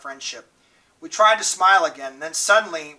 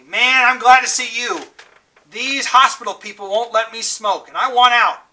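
excited greeting; 6 semitones higher, 7 dB louder than usual. A narrator's reading. Dally greeting them loudly.

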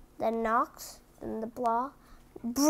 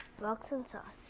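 plain reading voice

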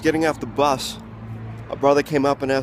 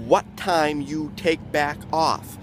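slowed down; much slower than usual. Shown as a demonstration. Comic explaining the phrase to the crowd, slow and deliberate. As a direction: lay it out slowly so everyone hears it. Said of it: "he said it so quickly".

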